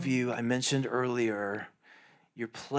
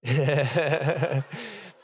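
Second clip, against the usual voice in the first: loud laugh; 3 semitones higher, 5 dB louder than usual. Real, not acted. Laughing at the men's response.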